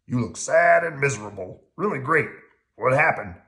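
loud grouch relishing misery; gleefully grouchy; Oscar. A narrator's reading. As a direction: gloat loudly in a gruff puppet voice. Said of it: "Oscar called".

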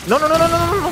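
high, loud panicked no's; panicked yelling. Real, not acted. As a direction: shout in sudden alarm. Player walking into danger.

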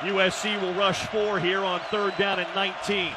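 normal talking voice

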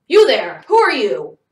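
very loud, curt interrogation; angry demand. A narrator's reading. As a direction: shout at an intruder. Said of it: "he demanded".

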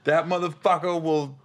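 ordinary talk, nothing marked

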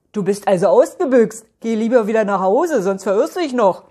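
loud, brisk character call; calling after. Acted. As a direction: call a quick warning after someone leaving. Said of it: "ruft ihm Max hinterher".

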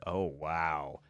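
normal talking voice

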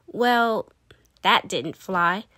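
ordinary delivery

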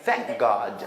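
"Thank God" with thank stopped short before God; held K in "Thank God"; blocked breath on K. Shown as a demonstration. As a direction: choke off "Thank", then release "God". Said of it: "thank에서 숨 막아야 돼".

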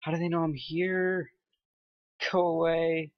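panicking gamer. Real, not acted. Tense countdown, enemies close.

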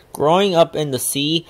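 plain reading voice

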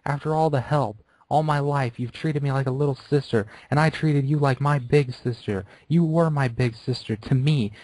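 high, crying plea to her idol; tearful pleading. Acted. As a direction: beg through tears. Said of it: "Tears were pouring down her face".

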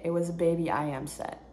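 ordinary talk, nothing marked

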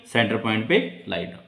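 normal talking voice

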